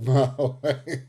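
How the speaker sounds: loud delivery dissolving into a laugh; breaking into laughter. Real, not acted. Streamer reading a viewer's joke about their looks, cracking up. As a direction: read it out, cracking up at the end.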